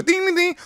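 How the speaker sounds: high, soft sing-song dings; bouncy ding noises. Retold or imitated. Comedian imitating the president's little bounce with high soft dings.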